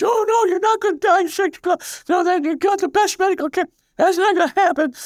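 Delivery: very high-pitched imitation of an upset mother; protesting mother. Mother refusing to accept her son's predicted death, high-pitched protest. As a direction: act out a mother's shrill, disbelieving denial. Mother's alarmed denial acted out.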